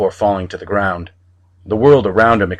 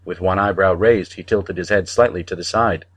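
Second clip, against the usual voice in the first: ordinary delivery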